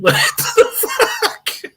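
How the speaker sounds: breathy laughing what the fuck; half-laughed baffled question; laughing disbelief. Real, not acted.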